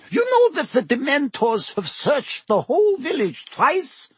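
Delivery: high-pitched landlady with an edge; slight edge. A narrator's reading. Landlady complains to the Minister about Dementors, slight edge. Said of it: "a slight edge to her voice".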